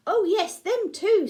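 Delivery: put-on high, loud old lady voice; bright grandma voice; Nan voice. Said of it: "said Nan".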